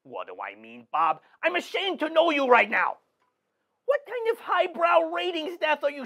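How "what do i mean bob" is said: mocking echo.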